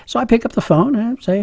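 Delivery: usual speaking voice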